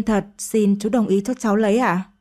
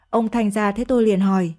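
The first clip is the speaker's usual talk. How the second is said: ordinary delivery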